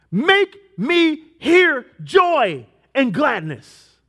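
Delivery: slow, soaring, high and loud scripture read; fervent psalm reading. Acted. Preacher reads David's psalm to the congregation, slow and ringing. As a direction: proclaim each plea to the church, drawn out and ringing.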